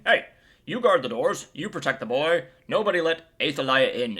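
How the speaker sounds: rally the guards with urgent orders; barked orders. Retold or imitated. Acting the priest's orders to guards.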